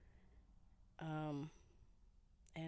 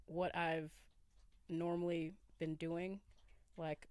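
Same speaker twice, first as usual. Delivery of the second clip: normal talking voice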